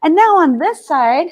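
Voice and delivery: ordinary talk, nothing marked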